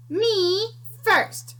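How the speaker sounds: high greedy "me first"; pushy piglet. Acted. Pig calling out in the cafeteria, greedy and high. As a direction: shove your way to the front.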